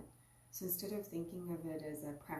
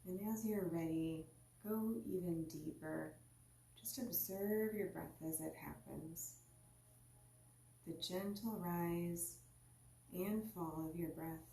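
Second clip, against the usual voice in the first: guided meditation. Acted. Teacher guiding listeners into their seat, calm meditation voice. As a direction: ease the listener inward, unhurried.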